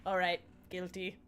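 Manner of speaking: sheepish admission. Retold or imitated. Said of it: "And he goes".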